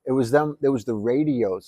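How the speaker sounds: ordinary delivery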